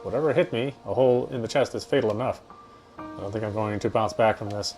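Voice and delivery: ordinary talk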